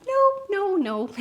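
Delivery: high, bright nervous denial; cheerful over-polite no; Canadian happy. Retold or imitated. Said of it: "just Canadian happy".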